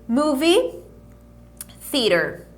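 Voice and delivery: syllable by syllable drill; split pronunciation. Shown as a demonstration. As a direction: break "Movie" and "theater" into two slow parts. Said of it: "atenção nessa pronúncia aqui".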